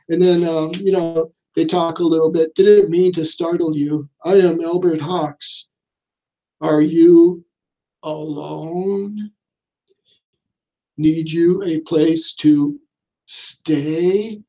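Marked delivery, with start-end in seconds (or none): 2.5-14.4 slow, drawn-out lightly voiced creepy motel owner; a hint of a sinister host, taking his time; creepy innkeeper welcoming a lone guest, slow and deliberate; Albert Hawks. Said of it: "So yeah, that's Albert Hawks".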